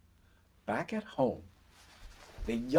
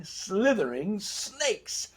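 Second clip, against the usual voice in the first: ordinary delivery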